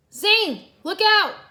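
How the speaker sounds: high, loud warning shout. A narrator's reading. Cole yells to warn Zane of the beam, loud shout. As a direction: yell a warning at a teammate. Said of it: "Cole shouted".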